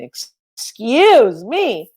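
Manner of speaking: slow, drawn-out excuse me; incredulous. Retold or imitated. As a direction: stretch it out in disbelief. Incredulous at jawline claim.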